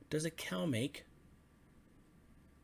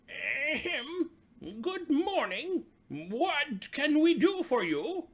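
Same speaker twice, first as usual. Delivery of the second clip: much higher and louder, slow and formal frog chief; chief frog speaking up; 11 semitones higher, 9 dB louder, slower than usual. Acted. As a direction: clear the throat and address the visitor grandly, slowly. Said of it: "the chief frog spoke up".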